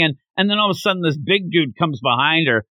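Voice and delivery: ordinary talk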